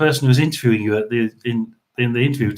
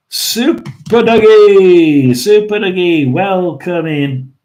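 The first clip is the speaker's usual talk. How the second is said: loud, high, stretched-out name call; big drawn-out welcome; 4 semitones higher, 5 dB louder, slower than usual. Real, not acted. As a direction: greet the newcomer with a long, hollered hello. Greeting a listener who joins.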